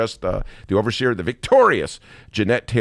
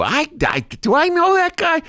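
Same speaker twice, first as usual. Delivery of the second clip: much higher, bewildered mayor; Daley impression; 4 dB louder than usual. Host as Daley feigning not to recognize a man, much higher. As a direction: pretend not to know him at all. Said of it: "Stellar Daley impression".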